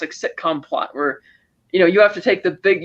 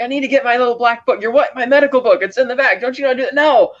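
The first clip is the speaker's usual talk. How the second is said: higher-pitched mock bickering; retold yelled argument; 6 semitones higher than usual. Retelling two men fighting over adrenaline shot instructions, mock yelling. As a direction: act out both sides of a squabble, pitch raised. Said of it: "yelling at each other about it".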